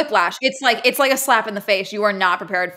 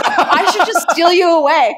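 higher, louder teasing villain line; mock playful abductor; 9 semitones higher, 6 dB louder than usual. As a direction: ham up a creepy joking suitor. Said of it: "And he says it like a joke".

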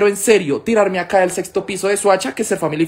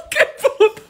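laughing exclamation. Real, not acted. Laughs at a smelly guest.

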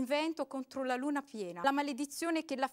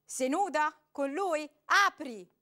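loud, higher furious demands; jealous man screaming at the door; jealous shout; 6 semitones higher, 10 dB louder than usual. A narrator's reading. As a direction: hurl the accusations at her.

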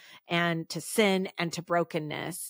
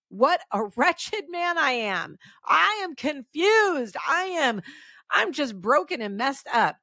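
wretched lament; 7 semitones higher than usual. Acted. Dramatic climax of Paul's lament.

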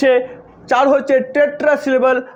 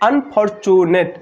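"Unfortunate" slow and lower, in four beats; syllable by syllable; 4 semitones lower, much slower than usual. Shown as a demonstration. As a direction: measure out every syllable of "unfortunate". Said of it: "চারবারে উচ্চারিত হচ্ছে".

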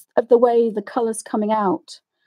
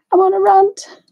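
repeats it higher through a laugh; 10 semitones higher, 5 dB louder than usual. Real, not acted. Admitting to viewers she is ranting, laughing. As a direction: laugh at yourself as you say it.

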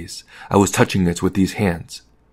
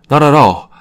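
higher, louder polite protest; lively, raised "no trouble" tone; emphatic reassurance; 7 semitones higher, 7 dB louder than usual. A narrator's reading. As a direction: brush the apology away emphatically. Hostess brushes off guest's apology.

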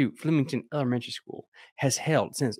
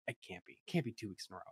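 quiet disbelief; 4 semitones lower, 15 dB quieter, faster than usual. Real, not acted. Muttering disbelief aside.

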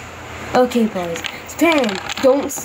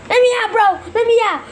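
higher, urgent demand; acted pleading shout; 6 semitones higher, faster than usual. Trapped character begging captors, urgent high shout. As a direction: holler to be released.

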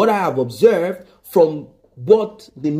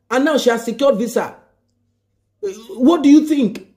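angry friend; 7 semitones higher than usual. Retold or imitated. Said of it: "[name] got angry".

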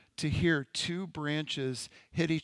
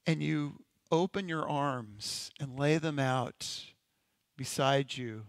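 unhurried guided imagery with long pauses; measured, contemplative meditation voice. Acted. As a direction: lead listeners gently into a daydream, leaving space between phrases. Leads a guided imagination exercise.

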